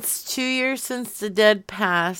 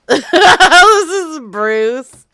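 giggling through a drawn-out intro; barely holding back laughter; laughing; 8 semitones higher, 13 dB louder, much slower than usual. Acted. Prank call opener, laughing.

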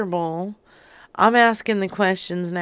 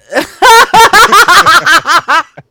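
big loud burst of laughter; 9 semitones higher, 16 dB louder than usual. Real, not acted. Laughing loudly at the seller's hoot remark. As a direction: explode into laughter.

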